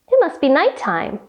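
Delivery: usual speaking voice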